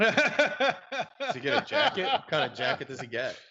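laughing. Real, not acted. Teasing about the jacket.

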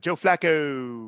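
Baltimore accent, slow, the final O of "Flacco" held long like "Flaccooo". Shown as a demonstration. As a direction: stretch out the last O of "Flacco" Baltimore style, slowly. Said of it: "you hold on to it".

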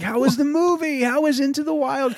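high, kind imitated girlfriend; high girlfriend impression asking about movie; sweet girlfriend voice. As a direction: lift it up sweet and caring.

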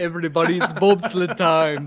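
hammed-up Jamaican rallying chant; Jamaican accent. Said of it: "I can do a Jamaican accent".

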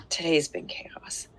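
a tired confiding whisper; slow whispered aside to the audience. Real, not acted. Aside to viewers amid kids.

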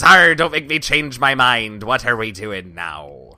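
lightly voiced gruff pirate. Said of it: "And then Sniff says".

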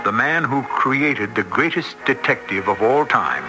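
ordinary talk, nothing marked

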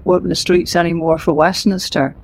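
ordinary talk

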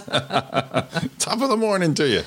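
high, drawn-out mock British accent; badly faked British greeting, stretched out slowly; fake British accent. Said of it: "fake British accent".

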